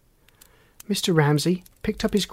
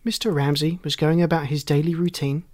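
usual speaking voice

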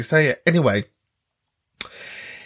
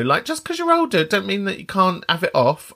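normal talking voice

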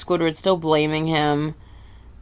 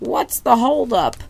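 put-on high, slow cranky old man voice; 7 semitones higher than usual. Old man at the counter impatiently asking about the holdup. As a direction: croak it like an impatient old geezer.

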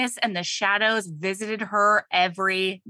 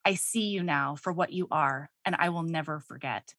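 low, soft confession of love; quiet, heartfelt vow; tender apology; 5 semitones lower, 6 dB quieter than usual. Acted. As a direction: read it gently, lowered and hushed. Reading the goddess's tender apology.

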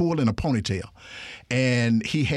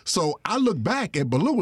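ordinary delivery

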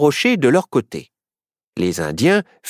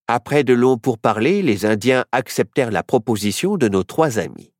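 ordinary delivery

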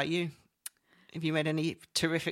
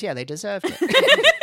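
laughing mock gloat; 5 semitones higher, 8 dB louder than usual. Mock gloating over closed shop.

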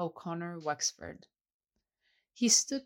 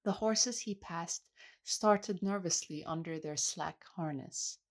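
plain reading voice

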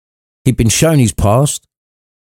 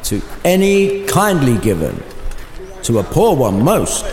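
spirit's reply; 3 semitones higher, 3 dB quieter than usual. Acted. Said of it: "came the reply".

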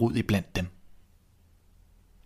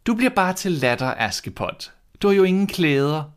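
high, loud sneer; scornful stepmother; 10 semitones higher, 3 dB louder than usual. Acted. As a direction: laugh her plans to scorn. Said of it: "Men hun sagde". (høj, hånlig stedmor)

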